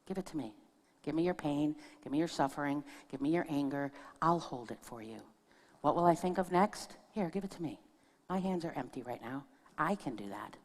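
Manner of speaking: soft, tender, calming voice; soothing offer. Retold or imitated. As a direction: offer to hold his pain gently. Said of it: "my energy was".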